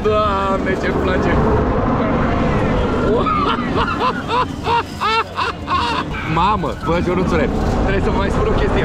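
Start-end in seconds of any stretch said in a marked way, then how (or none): 1.4-6.3 high airy laugh mid-ride; breathless ride laugh. Real, not acted. (râs gâfâit, foarte sus)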